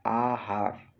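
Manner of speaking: ordinary talk, nothing marked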